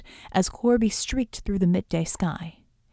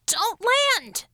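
screeched warning; 12 semitones higher than usual. A narrator's reading. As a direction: screech an urgent warning over the comm. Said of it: "Ramona's voice screeched in their comm units".

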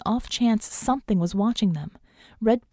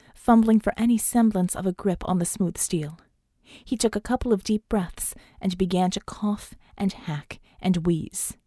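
ordinary delivery

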